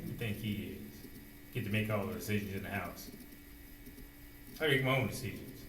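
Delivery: low, soft private muttering; talking to herself. Acted. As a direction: voice Eve's thoughts under the breath. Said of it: "what she was saying to herself".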